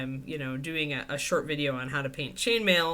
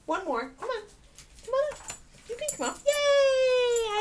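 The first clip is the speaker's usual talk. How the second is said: excited coaxing; 5 dB louder than usual. Real, not acted. Owner cheers dog onto her lap, very high.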